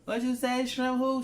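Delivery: put-on high-pitched, baffled child asking back; questioning kid. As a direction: squeal it like a kid who misheard. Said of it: "When you talk to your kids".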